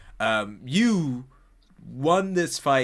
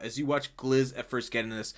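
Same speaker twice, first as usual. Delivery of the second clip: ordinary talk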